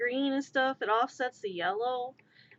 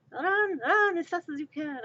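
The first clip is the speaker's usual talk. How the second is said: higher, playful gingerbread man voice; 6 semitones higher, 3 dB louder than usual. Acted. Gingerbread man fleeing pursuers, high playful taunt. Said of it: "I'm the gingerbread man".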